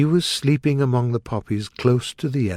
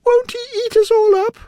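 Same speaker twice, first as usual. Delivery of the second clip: very high, fast, scared squeak; tiny queen panicking; squeaky worried question; frightened mouse; 5 dB louder, faster than usual. A narrator's reading.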